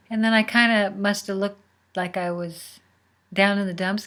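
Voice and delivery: ordinary delivery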